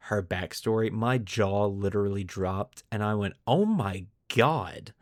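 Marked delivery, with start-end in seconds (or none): none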